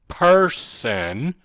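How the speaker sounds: person drawn out in two beats; stress on person. Shown as a demonstration. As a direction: lean hard on the word person. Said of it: "the reason I emphasize the word".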